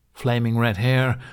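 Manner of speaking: plain reading voice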